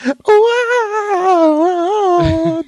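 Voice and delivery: squeaky high gopher shriek; [name] impression. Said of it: "That's my [name] impression".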